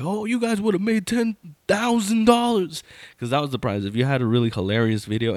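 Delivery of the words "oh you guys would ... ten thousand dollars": high-pitched mocking jeer; imitated teasing. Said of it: "he was, like, teasing".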